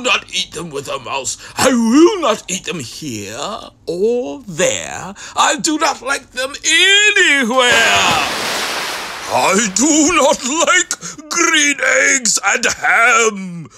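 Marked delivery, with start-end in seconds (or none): 5.4-13.7 emphatic loud refusal. Acted. Grump's final loud refusal to Sam. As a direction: lean hard on every word.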